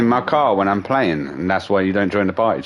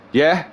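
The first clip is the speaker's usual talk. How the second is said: higher-pitched rising "Yeah?"; clueless questioning yeah; 6 semitones higher than usual. Retold or imitated. Friend who hasn't heard properly, rising clueless "Yeah?". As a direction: lift "Yeah?" like someone who missed it all. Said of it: "there'll be a little pause, and he'll go".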